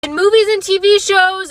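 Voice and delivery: normal talking voice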